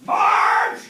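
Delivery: loud call across the office. Acted. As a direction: shout her name to summon her. Bob calls out for Marge.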